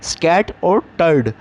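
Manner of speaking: "स्कैट और टर्ड" as two separate chunks; syllable split. Shown as a demonstration. Teacher splitting the word for students, syllable by syllable. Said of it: "स्कैटर्ड में भी दो sound हैं".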